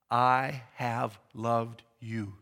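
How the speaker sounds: each word drawn out very slowly; slow, lingering reading. Shown as a demonstration. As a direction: linger over every word tenderly. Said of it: "There's the burden of the word".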